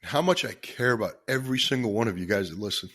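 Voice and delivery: fighting back tears to keep talking to listeners; choked up with emotion. Real, not acted. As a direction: voice catching, long pauses between phrases. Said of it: "I get pretty emotional about this stuff".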